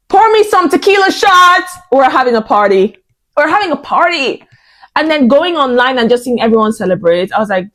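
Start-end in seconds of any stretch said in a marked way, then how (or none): 0.1-4.4 party cheer. Retold or imitated. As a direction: call for shots in glee. Celebrating Horner leaving.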